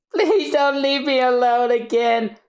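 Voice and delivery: high crying voice; a daughter crying to her mother; tearful plea. Acted. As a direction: plead through tears. Robin begs.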